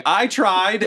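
high-pitched mock yell; imitated yelling. Recapping Juniper hit by a trident, mock yelling. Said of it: "This is Juniper yelling".

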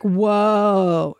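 drawn-out falling "whoa", mock amazement; acted whoa. Mocking the heroine she's reading, a long acted whoa. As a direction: mimic a wowed heroine, stretching the word. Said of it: "She says that. She goes".

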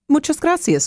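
"Muchas gracias" said as a quick, breezy Spanish thank-you. Real, not acted. Host thanking the reporter, breezily, in Spanish "Muchas gracias". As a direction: toss off "Muchas gracias" in Spanish, then come back to English. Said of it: "I switched out of English there".

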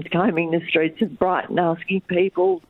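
ordinary delivery

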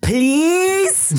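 very high, drawn-out mock child please; toddler's sassy please. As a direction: squeak it up like a cheeky toddler. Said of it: "she looked at me and she went".

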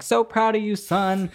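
high, sappy proud-parent voice; mocking proud parent. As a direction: gush it like a doting mom. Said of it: "being like".

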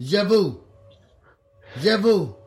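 learner's Russian with a French sound; Russian word with a French accent. Retold or imitated. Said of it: "That sounds French".

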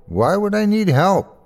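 high, innocently puzzled question; as if help were an unknown word; innocent puzzlement. A narrator's reading. Injured Terry to narrator, innocently puzzled. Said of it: "like he'd never heard it before".

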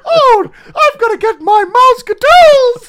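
very high, loud and slow squeak; cartoon mouse, laughing through it; Mickey Mouse voice. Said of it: "He's also Mickey Mouse".

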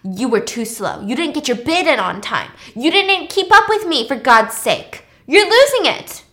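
acted-out telling-off that rises to an outburst; mad. Virgil scolds Snow in his office, mounting mock anger. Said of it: "and he's mad".

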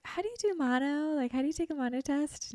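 high, anxious asking voice; nervous high question. Retold or imitated. Asking the nurse nervously, in a high, anxious voice. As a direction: squeak it out nervously.

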